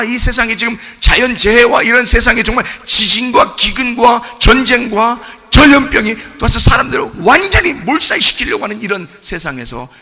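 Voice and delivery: ordinary talk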